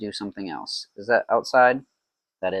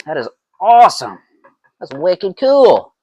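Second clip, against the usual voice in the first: excited; 7 semitones higher, 9 dB louder than usual. Real, not acted. Gushing over the mead's colour.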